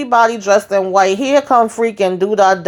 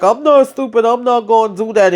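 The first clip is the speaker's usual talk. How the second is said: defiant retort; 3 semitones higher than usual. Retold or imitated.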